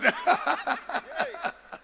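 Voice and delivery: high, drawn-out cackle; long amused laugh; real laugh. Real, not acted. Laughs at his own scenario.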